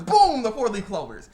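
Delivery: high, very loud boom; exclaimed magic reveal. Retold or imitated. Recapping clovers turned lucky by fortune, booming exclamation. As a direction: blast it out in playful amazement. Mimics magical instant fix.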